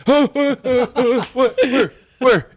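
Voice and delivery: high, loud, flustered half-laughing; frantic searching. Retold or imitated. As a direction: scramble to see where it is. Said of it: "and I'd be like".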